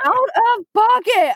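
high, drawn-out scandalized echo; mock outrage. Indignant echo of friend's remark.

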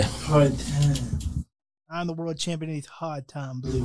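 soft, low bluesy drawl; blues singer voice. Pretend. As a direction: moan it like a weary bluesman. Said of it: "We don't own rights to that voice".

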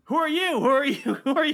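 very high, loud squeaky robot voice; high-pitched robot asking who are you; mock robot confusion. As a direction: squeal it like a baffled little robot. Two Alphas meeting, mock voice.

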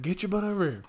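retold stern order. Counselor orders defiant boy over, retold high-pitched command.

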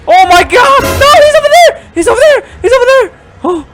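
high, loud frantic shouting; panicked yelling. Real, not acted. Gamer spotting the monster close by, frantic high yelling. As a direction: yell the warning in panic.

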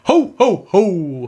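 belly-laugh it like Santa. Shown as a demonstration. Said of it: "let's hear your, uh, your, your, like, ho, ho, ho".

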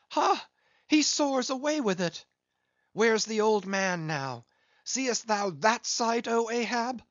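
very high, loud cries; frantic lookout cry. A narrator's reading. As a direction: scream down from the mast.

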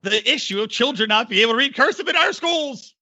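high, pompous mock outrage; mock politician. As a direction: play a grandstanding politician.

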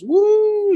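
very high, drawn-out "woo"; crowd whoop. Retold or imitated. Crowd answers the bar owner, imitated high whoop. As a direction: imitate a party crowd cheering.

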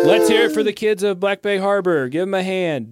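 high, shouted call for applause; rally a festival crowd to cheer; hyped concert host; stage frontman. Acted.

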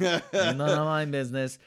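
hammed-up Bronx accent; Tony. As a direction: repeat it as the Bronx brother's catchphrase. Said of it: "he's totally from, like, the Bronx".

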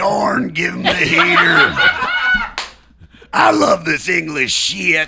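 loud high hammy coach yelling; pitching coach impression. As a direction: bellow it like a coach losing it. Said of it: "the pitching coach, he goes".

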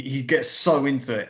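normal talking voice

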